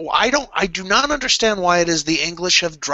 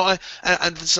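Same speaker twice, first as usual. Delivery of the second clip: normal talking voice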